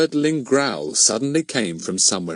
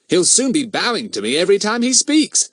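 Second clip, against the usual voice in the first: amused thought; 10 semitones higher, 4 dB louder than usual. A narrator's reading. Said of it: "thought Fatty".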